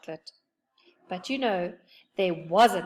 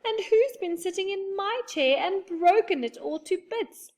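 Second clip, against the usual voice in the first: much higher, louder squeak, close to tears; high little bear voice, mock tearful; squeaky tearful baby bear; 12 semitones higher, 4 dB louder than usual. A narrator's reading. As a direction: squeak it like a tiny bear about to cry. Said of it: "squeaked the baby bear in tears".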